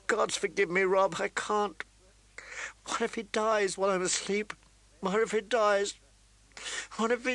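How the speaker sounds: high, tearful cry; weeping. Acted. Catelyn weeping to her son. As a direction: weep the refusal. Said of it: "she wept".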